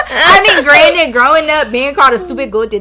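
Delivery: high, loud laughter through words; can barely speak for laughing; laughing hard. Real, not acted. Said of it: "I am weak and y'all childish".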